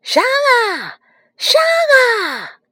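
very high, drawn-out battle cry; clamouring sea soldiers. A narrator's reading. Shrimp and crab soldiers leap up, clamouring.

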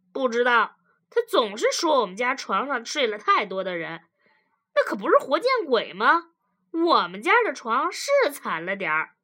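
loud, animated husband protesting; indignant husband. Acted. Husband denies his wife's claim to the therapist, loudly indignant.

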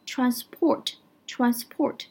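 transport with the weight on port, very slow; stress on the second syllable. Shown as a demonstration. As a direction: say transport slowly and land hard on port. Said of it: "它的重音是放在后面的".

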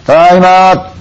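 long high call into the house. Acted. Woman calling to her friend inside the inn. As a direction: sing out to announce you are back.

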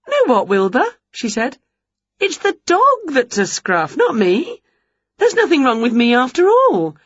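high, lively witch voice; bright eager witch teasing her pets; Winnie's voice. A narrator's reading. Witch quizzing the dog and Wilbur, high and lively.

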